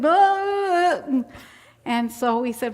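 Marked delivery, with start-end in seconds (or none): 0.0-1.2 long, high loud holler; imitated wordless blurt. Storyteller imitating Eddie's answer to a greeting, wordless blurt. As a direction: stretch out a garbled high yell. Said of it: "and he went".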